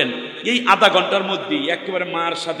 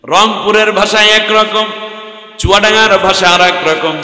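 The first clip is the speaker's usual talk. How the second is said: higher, louder sermon call; preaching at full force; 7 semitones higher, 7 dB louder than usual. Acted. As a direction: ring out the list of regions. Preacher rises. (জোরালো ওয়াজের সুর)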